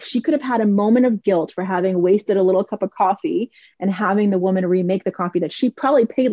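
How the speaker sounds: ordinary delivery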